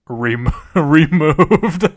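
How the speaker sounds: cracking up mid-word; laughing. Real, not acted. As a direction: try to read it through real laughter. Cracks up reading the punchline.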